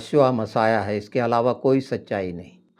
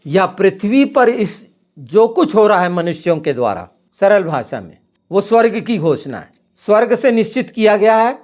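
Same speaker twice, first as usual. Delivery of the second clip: rising, louder and higher preaching; fiery preaching; 7 semitones higher, 6 dB louder than usual. Acted. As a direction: drive the declaration home. Preaching builds to a climax. (जोशीला, ऊँचा होता प्रवचन)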